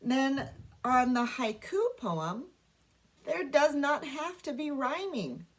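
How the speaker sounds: normal talking voice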